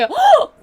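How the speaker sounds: sharp loud high gasp; startled gasp. Retold or imitated. Waking from a nap in shock, gasping. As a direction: jolt awake with a gasp. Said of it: "갑자기 ... 졸라 깜짝 놀라가지고".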